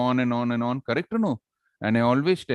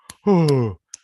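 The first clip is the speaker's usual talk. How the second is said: loud, heavy sigh; mock audience groan-sigh; exasperated sigh; 7 dB louder than usual. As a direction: let out a big sigh of boredom. Acting the bored audience's reaction.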